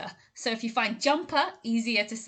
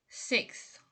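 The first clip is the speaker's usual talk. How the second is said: whispered; 6 dB quieter than usual. Shown as a demonstration. Pronunciation model word.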